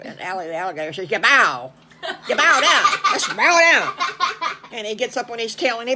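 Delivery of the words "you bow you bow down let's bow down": loud, rising mock shout; hammed-up bossy holler; urgent command. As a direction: order them all down, louder each time. Alligator urges everyone to bow.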